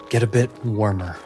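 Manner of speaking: normal talking voice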